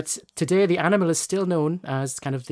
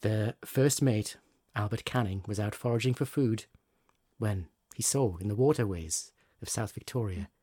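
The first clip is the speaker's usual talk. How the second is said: fireside storytelling; 4 semitones lower than usual. Acted. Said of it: "You've got your storytelling voice on".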